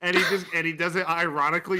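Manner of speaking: ordinary talk, nothing marked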